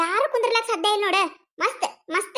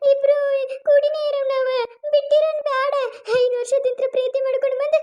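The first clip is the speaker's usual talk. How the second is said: tearful pleading; 6 semitones higher than usual. Acted. Lover begging her man to stay, high tearful plea. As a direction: beg him not to leave her, near tears. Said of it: "ನೀ ಯಾಕ್ ಅಳ್ತಿ ಚಿನ್ನು?".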